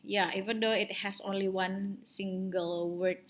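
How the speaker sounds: usual speaking voice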